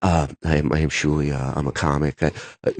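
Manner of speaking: very low, hesitant monotone; mumbly newcomer stumbling through an introduction; meek reply. Retold or imitated. Meek newcomer answering intimidating star.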